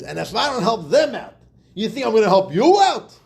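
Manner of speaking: loud, high mock tirade; retold yell. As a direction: yell it back like an indignant rich man. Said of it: "starts yelling back at him".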